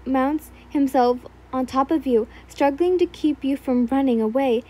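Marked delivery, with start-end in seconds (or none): none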